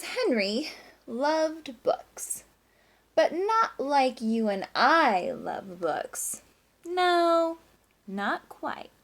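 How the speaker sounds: slow storybook read-aloud voice; reading to children. A narrator's reading. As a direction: read it slowly to the class, savouring each line.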